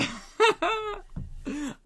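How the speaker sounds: high-pitched laughing fit; helpless laughter. Real, not acted. Comic unable to finish his insult, laughing. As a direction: lose it laughing.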